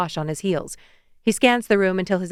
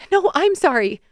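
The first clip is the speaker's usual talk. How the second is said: higher, insistent "No, I'm sorry"; eager apology; 10 semitones higher, 4 dB louder than usual. Acted. Macy pleading with Noah, higher and insistent. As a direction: jump in over him, pleading.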